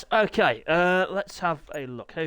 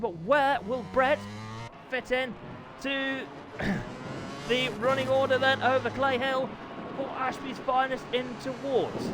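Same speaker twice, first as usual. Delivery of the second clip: higher-pitched live race commentary; live play-by-play; 7 semitones higher than usual. Real, not acted. Commentator following Brett's qualifying lap, animated play-by-play. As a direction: call the race lap as it happens.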